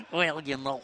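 low, slow Scouse footballer interview; Jamie Carragher. Acted. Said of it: "Jamie Carragher's up here".

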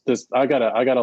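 usual speaking voice

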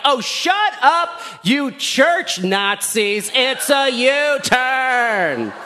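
high, drawn-out outraged holler; indignant mock yell. Driver yelling at honking traffic, indignant yell. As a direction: stretch every word in comic road rage.

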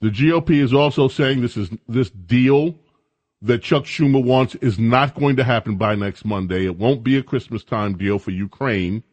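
usual speaking voice

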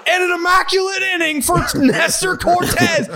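high, excited play-by-play call imitation; mock sports broadcaster. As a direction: parody a broadcaster's big highlight call. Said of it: "a fucking supercut of broadcasters".

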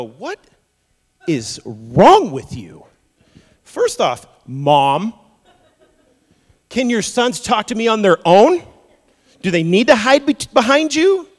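loud, incredulous scolding; a loud, mock-outraged rebuke of a pushy mom; exasperated rebuke. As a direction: tell her off in disbelief. Imagined Jesus rebuking the mother.

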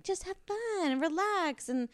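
high, slow singsong voice; mock breezy advice. Everyone advising a woman trying to conceive, mock breezy singsong. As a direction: parody well-meaning advisers, soft and drawn out.

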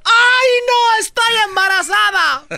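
high, loud shocked wail; mock shocked cry. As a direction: shriek it in mock panic. Acted shock of pregnancy.

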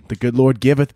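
normal talking voice

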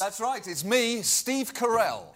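lightly put-on British accent. Boasting to coworkers, a light put-on British accent. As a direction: play the pompous British star. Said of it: "that horrible British accent".

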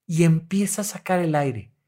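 ordinary talk, nothing marked

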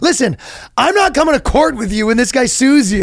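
mock exasperation. Same boyfriend refusing to come to court.

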